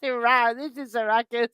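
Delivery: high cutesy growl; cute monster voice. Pretend. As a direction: introduce yourself like a toy monster. Voicing the plush monster.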